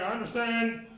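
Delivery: a hint of a stiff official cop, loud. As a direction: play the formal officer, loudly. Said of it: "very cop-like, very".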